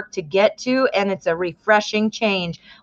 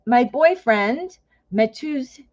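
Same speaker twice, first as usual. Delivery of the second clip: ordinary talk, nothing marked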